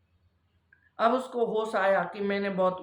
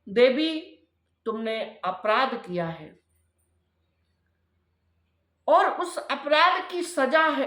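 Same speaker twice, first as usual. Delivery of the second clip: raised, emphatic voice of a rebuking sage; lightly acted rebuke, projected to a congregation; reproachful sage; 5 semitones higher, 4 dB louder than usual. Sage telling his wife of her offence, stern reproach.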